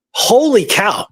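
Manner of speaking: ordinary talk, nothing marked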